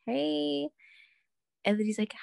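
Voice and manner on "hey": high, forced second greeting; awkward hey. Retold or imitated. Greeting the awkward ex again, strained and high. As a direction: keep the fake cheer going. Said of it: "And then I was like".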